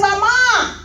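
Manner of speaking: urgent retold yell; calling out. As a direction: shout the son's name into the dark. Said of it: "He yells for his son again".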